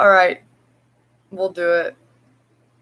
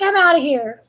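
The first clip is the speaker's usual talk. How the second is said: higher, lightly voiced sassy cat; voicing the cat; 8 semitones higher than usual. Owner voicing cat leaving, lightly voiced sassy cat. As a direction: give the cat a huffy little voice. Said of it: "she's like".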